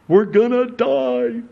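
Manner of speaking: sudden high cry of mock terror; a hammed-up cry that the boat is going down; acted panic. Disciples panicking in the storm.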